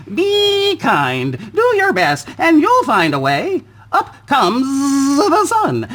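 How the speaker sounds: very high, sing-song bee voices; high chirpy bee chorus. Acted. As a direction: buzz out cheerful advice. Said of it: "those were the bees".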